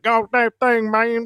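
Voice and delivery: high, mumbly Boomhauer voice, tossed off; Boomhauer impression. Guest showing his failed Garrett try, a high Boomhauer mumble. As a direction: slip into a jokey Boomhauer mumble. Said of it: "I ended up sounding like Boomhauer".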